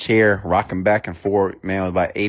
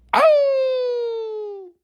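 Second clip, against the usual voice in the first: long, high imitated dog howl; high-pitched hound's howl, drawn out. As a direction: howl like a dog, sliding slowly down. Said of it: "crying and howling".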